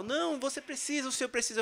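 high, pleading voice of the brothers; urgent plea from the brothers; insisting brothers. Retold or imitated. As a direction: beg him to stay, pitched well up.

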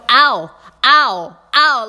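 high, loud yelps of pain, each ow hammed up; mock pained ow. Retelling being stepped on, loud mock yelps. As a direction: cry out ow ow ow like a stepped-on foot. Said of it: "like this".

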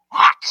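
sudden loud choked hack; choking hack. Retold or imitated. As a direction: cough it out like a man being throttled. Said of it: "Coop calmly says".

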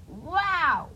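high, loud storybook wow; amazed. Acted. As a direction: let the wow burst out in wonder. Amazed by the throne.

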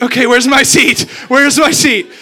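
flustered loud blurt. Retold or imitated. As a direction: blurt it out in awkward panic. Flustered passing the celebrity.

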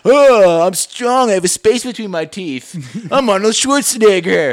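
bad mom-style action hero boast; mom's Terminator impression. Mocking his mom doing Arnold Schwarzenegger. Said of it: "Mom, do the Terminator".

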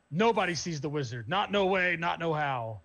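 lightly voiced gatekeeper, loud and emphatic; loud, mock-official refusal at the door; gatekeeping orc. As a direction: turn them away with a hint of hammy firmness. Orc gatekeeper refusing entry, movie quote.

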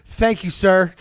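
loud, high mocking "thank you, sir"; hammed-up prim heckler; mock heckler lady. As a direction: blurt it like the mic lady. Mimicking heckler lady's line.

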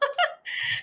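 breathy giggle; laughing. Real, not acted. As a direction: laugh at yourself. Laughs at own mistake.

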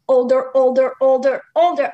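slow, repetitive mocking of procrastination; dragged-out repetition. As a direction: stretch each "older" to sound never-ending. Mocks endless postponing by repetition.

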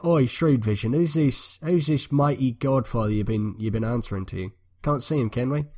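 mock Cockney bandit patter; hammed-up Cockney street talk. Bandit challenging Shrewd Vision about his godfather, Cockney. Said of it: "like, Cockney people".

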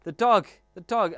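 imitating a scared little daughter, high and loud; scared little girl. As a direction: squeal like a toddler frightened of a dog. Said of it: "she was scared of the dogs. So she'd come and she'd be".

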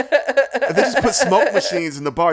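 excited. Real, not acted. Excited fantasy, high and loud.

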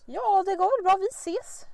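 high, loud breezy brush-off; mocking a friend's breezy reply to nosy friends; dismissive brush-off. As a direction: wave them off with a breezy answer. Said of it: "jag hatar när kompisar bara".

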